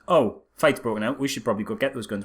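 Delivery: ordinary talk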